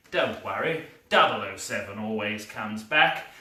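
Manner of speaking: low trailer-voice tagline; mock trailer voice. Closing the film with a mock trailer line, low voice. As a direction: intone it like a movie trailer.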